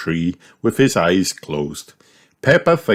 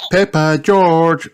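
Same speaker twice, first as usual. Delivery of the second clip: high, slow sing-out call; 11 semitones higher, 4 dB louder, slower than usual. A narrator's reading. Mummy stopping the children, calling out. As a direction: call the children with drawn-out names.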